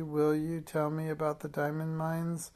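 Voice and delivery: ordinary delivery, nothing marked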